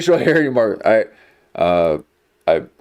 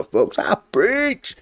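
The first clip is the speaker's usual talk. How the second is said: "I preach." up high in a put-on preacher voice; preachy voice; 8 semitones higher, 3 dB quieter, slower than usual. Vlogger showing off his preaching voice, high and put-on. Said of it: "I preach though".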